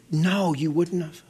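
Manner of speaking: lightly voiced soft, slow reassurance; wife's gentle reassurance. Wife calming her husband on the phone, soft and slow. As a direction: soothe him slowly, a hint of the wife. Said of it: "And she said".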